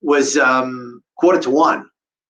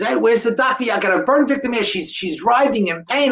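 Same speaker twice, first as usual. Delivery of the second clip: raised, higher urgent call; retold yelling; 7 semitones higher than usual. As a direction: call out urgently for a doctor, pitched up. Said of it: "I started yelling".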